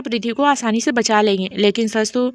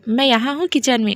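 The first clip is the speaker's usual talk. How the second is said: ordinary delivery, nothing marked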